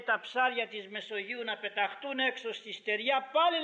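high-pitched, exasperated objection; imitating a man arguing in disbelief; incredulous protest. Moses protests to God in disbelief.

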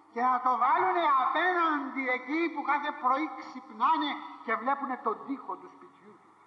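ordinary talk

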